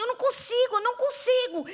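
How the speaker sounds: put-on high, whiny little-girl complaint; lightly voiced child who can't dress her doll; imitating a frustrated little girl. Little Laura tells her sister she can't dress the doll, whiny. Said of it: "E ela fazia assim".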